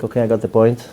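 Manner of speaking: soft, low, bored sigh; bored listener. Retold or imitated. Imitating bored audience members, soft low fed-up murmur. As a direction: sound fed up and done. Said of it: "some of you are already like".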